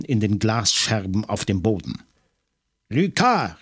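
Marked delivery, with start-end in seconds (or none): 2.9-3.6 loud call. A narrator's reading. Maigret, wrestling on the floor, calls his colleague loudly. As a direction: shout the name out for help. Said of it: "rief Maigret, so laut er konnte".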